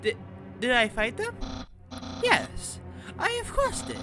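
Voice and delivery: swooping, very high cartoon voice; high cartoon character reporting in. Underling reporting on the human to a superior, high cartoon voice.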